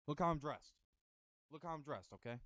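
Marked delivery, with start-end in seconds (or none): none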